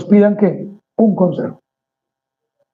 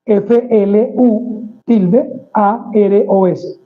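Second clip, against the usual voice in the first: deletrea letra por letra, muy despacio; spelled out slowly; much slower than usual. Shown as a demonstration. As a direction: dicta cada letra con calma, como al tablero. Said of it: "con tilde en la U". (deletreo lento, letra por letra)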